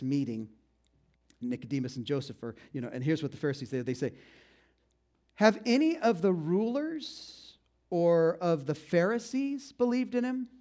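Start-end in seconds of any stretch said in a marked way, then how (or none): 5.4-10.5 slow, drawn-out talking down; condescending. Acted. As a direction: stretch each word like they're fools. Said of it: "talking in a very condescending way".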